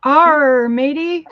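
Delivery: loud, high hammy pirate. Pretend. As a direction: growl it out like a pirate captain. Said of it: "Shouldn't you have said that like a pirate?".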